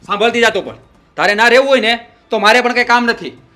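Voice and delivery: loud, high defiant yelling; angry shout. Acted. Husband daring wife to leave, angry shout. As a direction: dare her to leave.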